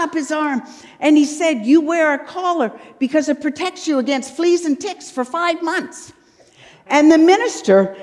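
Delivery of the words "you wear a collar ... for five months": lightly voiced eager little boy, a touch higher; little boy answering. A hint of a little boy answering his minister, eager. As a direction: pipe up like a keen child who knows the answer.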